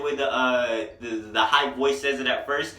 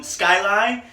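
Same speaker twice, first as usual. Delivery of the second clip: normal talking voice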